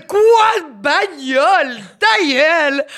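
slow, high, loud shout in mock outrage. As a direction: yell it like a heckler. Imitation criée, en riant. (cri moqueur, lent et aigu)

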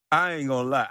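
normal talking voice

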